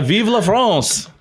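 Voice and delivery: high, slow, proud cheer; hammed-up patriotic French cry; mock rally cry. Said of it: "burst out".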